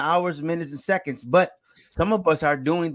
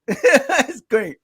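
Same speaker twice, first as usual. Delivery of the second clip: laughing; 8 semitones higher, 5 dB louder than usual. Real, not acted. Laughing at his dad's legacy.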